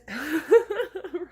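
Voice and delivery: sudden high, loud laugh; laughing at own slip. Real, not acted. Narrator cracks up over a wrong voice, real laugh. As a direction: burst out laughing at the mistake.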